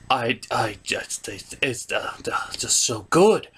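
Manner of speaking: breathless whisper. Acted. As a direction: swoon over it in a hush. Grandmaster gushing.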